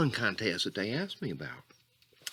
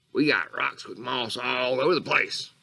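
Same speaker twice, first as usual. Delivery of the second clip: ordinary talk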